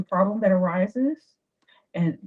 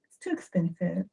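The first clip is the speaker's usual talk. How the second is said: high soft "Oh" then a quiet sigh of regret; coy demurral; 9 semitones higher, 8 dB quieter than usual. Shown as a demonstration. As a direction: fake a modest refusal. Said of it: "But you say".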